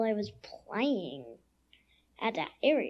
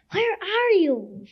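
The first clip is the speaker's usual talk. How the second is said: calling out; 8 semitones higher, 6 dB louder, slower than usual. Retold or imitated. Child searching for lost Barbie.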